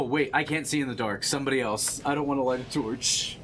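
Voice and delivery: ordinary talk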